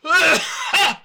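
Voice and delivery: loud, high retching cough; choking cough. A narrator's reading. Christiane chokes on the gas, loud retching cough. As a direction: gag and cough on fumes. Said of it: "Sie würgte und hustete".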